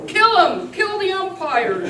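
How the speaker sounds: loud angry crowd yell, high; shout. A narrator's reading. A fan in the stands yelling at the umpire, shouting. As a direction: bellow it from the stands. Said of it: "shouted someone on the stand".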